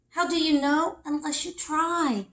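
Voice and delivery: lightly voiced bear cub encouraging; a cub nudging a friend on.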